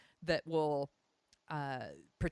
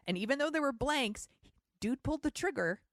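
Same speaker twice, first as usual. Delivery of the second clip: higher, louder, incredulous; outraged disbelief; 8 semitones higher, 3 dB louder than usual. Real, not acted. Host ranting to co-host about the plot, outraged. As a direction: rant at the absurd plot.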